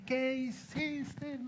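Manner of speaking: chillido agudo y alargado, sin mucho volumen; grito imitado. Retold or imitated. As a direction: imita a una hermana indignada, arrastrando las palabras. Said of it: "Empieza a gritarme". (reproche chillón fingido)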